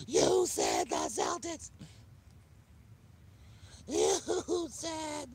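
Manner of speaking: very high, loud, drawn-out mocking voice; mock complaining viewers. Streamer imitating viewers holding him to his pick, high mocking wail. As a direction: whine it way up high like an outraged fan. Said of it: "a lot of you try to hold me to it".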